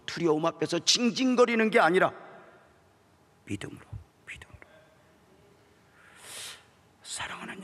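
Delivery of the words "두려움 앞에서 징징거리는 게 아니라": building louder and higher; rising preaching. Acted. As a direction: drive the point home. Building sermon point.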